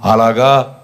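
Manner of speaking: low acknowledgement, imitated; accepting listener. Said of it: "అవన్నీ విని ఏమనాలి". (ఒప్పుకుంటున్నట్టు తగ్గు గొంతుతో)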